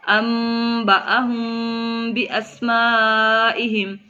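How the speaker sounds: slow, drawn-out Quranic chant with held vowels; Quran recitation. Shown as a demonstration. Teacher reciting the verse for students, slow tajweed chant. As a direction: recite it slowly like tilawat. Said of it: "teacher recites verse in tajweed".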